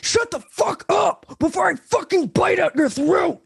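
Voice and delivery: high-pitched angry yelling; acted wolf-form fury; angry threat. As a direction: threaten the noisy neighbor at the door.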